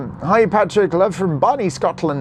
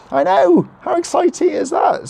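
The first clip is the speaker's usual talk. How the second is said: much higher, thrilled voice; bursting with excitement; excited; 12 semitones higher than usual. Real, not acted. Excited about visitors coming.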